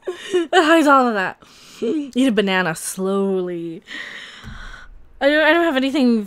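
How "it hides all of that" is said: laughing. Real, not acted. Laughing at own joke.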